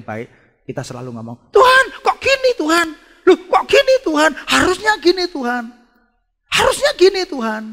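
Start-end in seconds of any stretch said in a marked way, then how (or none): 1.5-7.6 loud, high whiny complaint; mock a believer dictating to God; protesting to God. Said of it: "Protes sama Tuhan". (protes merengek lantang)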